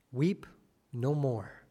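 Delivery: soft, low and tender comfort; Jesus, tender. Acted. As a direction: gently tell a grieving mother to stop weeping. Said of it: "speaks to her directly and says".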